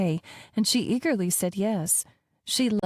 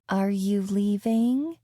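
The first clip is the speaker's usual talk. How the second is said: put-on taunting child; slower than usual. A narrator's reading. Child testing the nanny.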